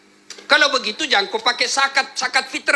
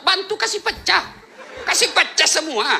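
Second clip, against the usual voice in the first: higher, laughing joke; 9 semitones higher than usual. Real, not acted. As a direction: laugh through the punchline. Joking advice, laughing. (sambil tertawa bercanda)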